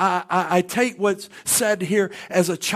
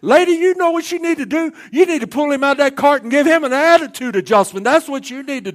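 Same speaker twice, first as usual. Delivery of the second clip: scolding bystander; 6 semitones higher, 5 dB louder than usual. Retold or imitated. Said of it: "you see most people stand there looking like".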